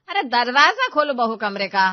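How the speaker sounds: loud, impatient call through a door; shouted order. Acted. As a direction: yell for the door to be opened. Ordering her to open door.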